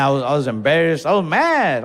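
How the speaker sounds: usual speaking voice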